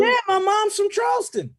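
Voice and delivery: high, loud burst of recognition; excited. Real, not acted. Excitedly confirms shared Geechee roots.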